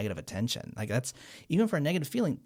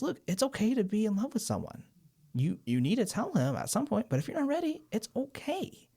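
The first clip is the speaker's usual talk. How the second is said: imitating a kind therapist, pitched much higher; gentle therapist; 10 semitones higher than usual. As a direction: retell the therapist's reassurance in a light high voice. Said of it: "she validates".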